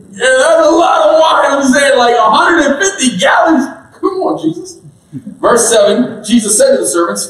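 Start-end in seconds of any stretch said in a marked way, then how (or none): 0.1-4.8 preacher marvelling at so much wine; excited. Real, not acted. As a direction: shout the amount in disbelief. Marvelling at 150 gallons of wine.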